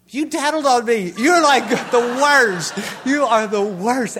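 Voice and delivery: mock-furious accusation at the machine; outraged retort. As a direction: yell at the self-checkout like a betrayed kid. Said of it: "And I'm like".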